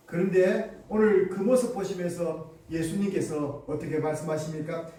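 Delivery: ordinary delivery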